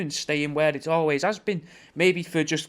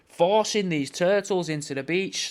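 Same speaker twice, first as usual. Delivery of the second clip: usual speaking voice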